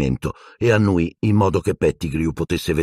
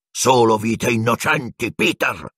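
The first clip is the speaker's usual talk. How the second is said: raised, indignant exclamation; wrathful retort; 5 semitones higher than usual. A narrator's reading. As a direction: answer in anger, pitch up. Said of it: "un'ira terribile stampata in viso".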